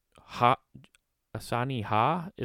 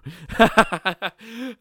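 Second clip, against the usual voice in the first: high laugh; 12 semitones higher, 5 dB louder than usual. Real, not acted. Laughing at own bit.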